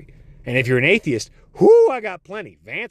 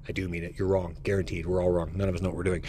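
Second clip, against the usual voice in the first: much softer and lower, thrown away under the breath; muttered aside; 10 semitones lower, 10 dB quieter, faster than usual. Acted. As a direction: mumble it like a private confession. Mumbled aside after joke.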